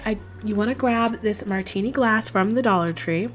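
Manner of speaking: normal talking voice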